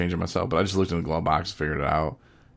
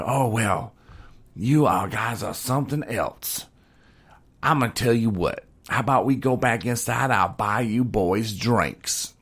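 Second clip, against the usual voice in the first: drawn-out, slightly raised lady's voice; grateful country lady; 4 semitones higher, slower than usual. Retold or imitated. Lady offering the boys drinks, playful country-woman voice. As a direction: play her country gratitude with stretched-out endings.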